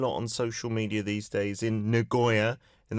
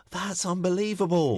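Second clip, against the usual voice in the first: amazed gasp; 8 semitones higher than usual. Real, not acted. Reacts to six weddings.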